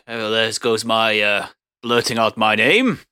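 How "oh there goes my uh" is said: normal talking voice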